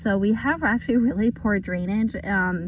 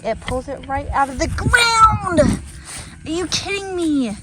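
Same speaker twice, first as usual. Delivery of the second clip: amazed; 6 semitones higher, 5 dB louder than usual. Real, not acted. Tool pulls weed out easily.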